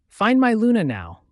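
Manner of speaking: loud, high urgent command; forceful, desperate shout; shouted order. A narrator's reading. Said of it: "I shouted".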